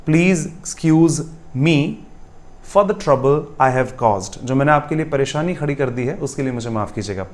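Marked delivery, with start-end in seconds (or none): 0.1-4.4 very slow and deliberate repeat. Shown as a demonstration. Teacher slowly repeating the correct sentence to students. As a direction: say it again slowly, word by word. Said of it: "I repeat".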